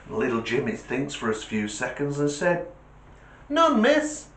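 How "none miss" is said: put-on kid voice. Child's answer in joke.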